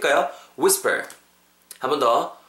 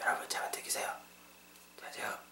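very soft, voiceless whisper; hushed whisper, as if it must stay secret; 17 dB quieter than usual. Shown as a demonstration. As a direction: demonstrate whispering to the listeners. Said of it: "속삭이는 거 이런 거 있잖아요".

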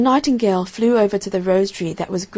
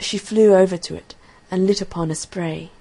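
usual speaking voice

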